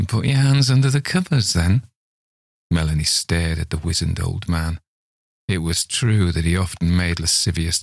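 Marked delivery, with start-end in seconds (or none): 0.1-1.9 put-on coaxing old man.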